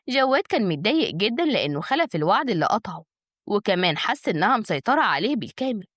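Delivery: ordinary delivery, nothing marked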